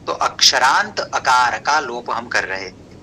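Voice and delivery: usual speaking voice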